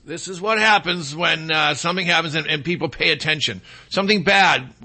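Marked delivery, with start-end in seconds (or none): none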